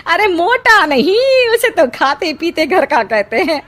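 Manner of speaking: sweeten the insult; gossiping aunt. Acted. Aunt sweetly corrects a relative about the fat groom.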